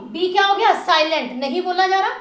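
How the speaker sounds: usual speaking voice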